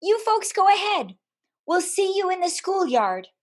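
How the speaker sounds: mom's voice. Retold or imitated. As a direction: lightly send the neighbours on ahead. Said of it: "and she said".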